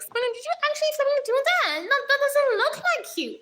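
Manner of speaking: high, fast squeaky voice of a shocked fan; mock shocked viewer. Streamer mimics her chat, high and fast in disbelief. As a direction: squeal it like a fan who can't believe it. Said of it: "y'all be like".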